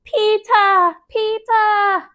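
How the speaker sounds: put-on "Peeta" called high, dropping the r like a toddler; "Peeta" as a tiny child's mispronounced Peter; toddler mispronunciation. A narrator's reading. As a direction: sing out "Peeta" in a little kid's high call. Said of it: "That's how he says my name".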